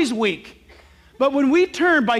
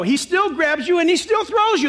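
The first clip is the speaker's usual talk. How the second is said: normal talking voice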